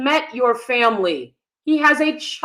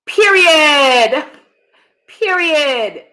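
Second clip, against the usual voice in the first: louder, higher Period with a rise; emphatic; 6 semitones higher, 4 dB louder, much slower than usual. Real, not acted. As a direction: punch Period out. Emphatic declaration.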